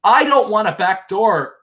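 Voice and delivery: high, put-on whiny voice mocking her; mocking Christy. Recapping Big Brother, mocking Christy's complaint in a high whine. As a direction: parody her indignant complaint. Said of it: "she says".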